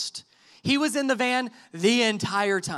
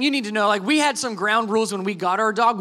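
ordinary talk

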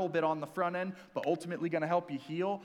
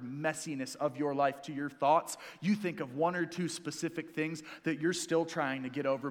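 ordinary delivery

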